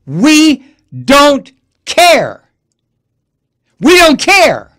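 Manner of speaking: high, loud, drawn-out sneering yell; mock yelling legislators. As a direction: belt it out slow, like a heartless chant. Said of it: "the Florida legislature said to high school students".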